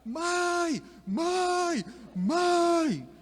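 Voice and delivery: very high drawn-out calls, rising to a shout; child calling for mom. Retold or imitated. A lost little boy calls his mother in the store, high and drawn out. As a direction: wail for your mother like a lost kid. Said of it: "Aí eu comecei a gritar".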